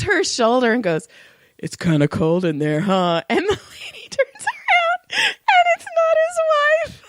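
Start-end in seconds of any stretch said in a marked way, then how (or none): 1.6-3.2 low, creepy come-on, not whispered; creepy murmur. Retold or imitated. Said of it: "creepily whispered in her ear". 3.3-7.0 high squealing, laughing till near tears; helpless laughter. Real, not acted. Teller breaks up at punchline.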